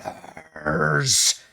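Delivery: slow, drawn-out ghostly hiss; unearthly voice. Acted. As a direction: stretch it out like a spectre. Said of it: "A ghostly voice emanating from".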